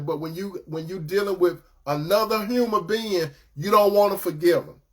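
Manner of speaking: normal talking voice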